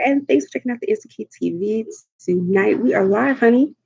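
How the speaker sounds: usual speaking voice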